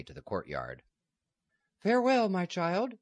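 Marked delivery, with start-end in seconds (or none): 1.8-3.0 much higher, louder tearful cry of farewell; exclaimed farewell. Acted. Abbess crying farewell to Margaret, high and loud. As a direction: call after her in anguish. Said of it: "the abbess exclaimed".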